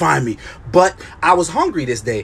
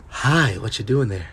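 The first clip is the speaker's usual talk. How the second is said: put-on creepy breathy girl; 8 semitones lower, 6 dB quieter than usual. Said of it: "her hot ass breath".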